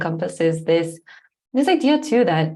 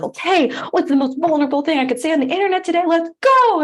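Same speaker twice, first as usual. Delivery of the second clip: high, loud, fast hype; hyped; 11 semitones higher, 5 dB louder, faster than usual. Retold or imitated. Host mocking a rush to overshare, high hype. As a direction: pump yourself up, fast and high. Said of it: "it can be like".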